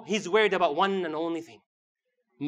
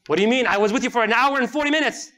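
loud, higher-pitched objection; mock indignant protest; 6 semitones higher, 6 dB louder than usual. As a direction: protest it as if you have been cheated. Acts protest about the time.

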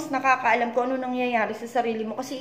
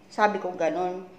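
ordinary talk

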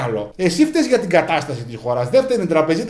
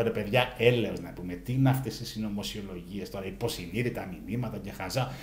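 low murmur; 10 semitones lower, 12 dB quieter than usual. Retold or imitated. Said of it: "Λέω".

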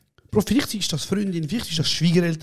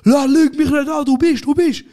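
much higher, loud insistent shout; fan getting louder; 10 semitones higher, 6 dB louder than usual. Retold or imitated. Pushy fan yelling he must be the famous guy. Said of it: "Wird immer lauter".

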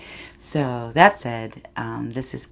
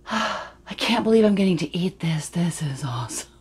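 breathy, hushed wonder sinking to a whisper; awed gratitude; 4 semitones higher than usual. Acted. [name] mimicking her friend's gratitude at food, hushed awe. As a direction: marvel under your breath, almost whispering. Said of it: "this look of pure gratitude crossing her face".

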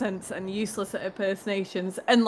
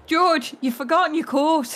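put-on higher-pitched Irish accent trigger phrase; 7 semitones higher than usual. Co-host shows the Irish trigger line, Irish accent. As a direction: call to George in an Irish accent. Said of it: "try and do ... The Irish".

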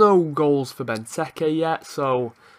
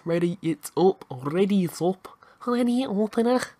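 put-on Scouse, climbing higher as it repeats; Scouse accent; 4 semitones higher than usual. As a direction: do a playful mock Scouse accent. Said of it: "Do you like my Scouse Saxon".

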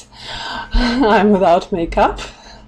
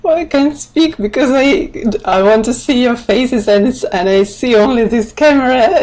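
higher, flustered, giggly voice; nervous excitement; 5 semitones higher, 3 dB louder than usual. Real, not acted. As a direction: stumble over your words in nervous excitement. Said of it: "it makes me a bit nervous".

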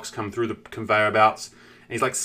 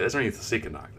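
normal talking voice

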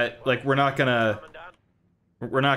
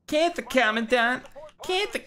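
high, nasal-free whiny parody; whiny mock echo; 13 semitones higher than usual. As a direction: squeak it back mockingly. Mocking the captain's whine.

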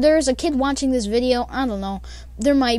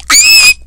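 piercing shriek, much higher and louder; imitated scream; 14 dB louder than usual. Narrator reenacting the girl screaming on the call: piercing shriek. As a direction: let out a shrill top-of-lungs scream.

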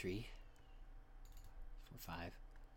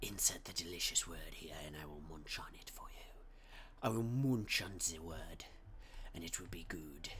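silly whisper; 7 semitones lower, 6 dB quieter than usual. Pretend.

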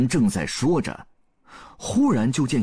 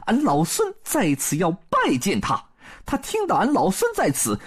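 high-pitched Monkey King explaining himself; 孙悟空 a bit embarrassed; 10 semitones higher than usual. A narrator's reading. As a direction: confide the awkward history in a high monkey-hero voice. (孙悟空高嗓门，略带不好意思地解释)

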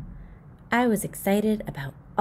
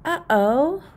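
uh-oh; 7 semitones higher, 5 dB louder than usual. Acted. Boy realizes trouble.

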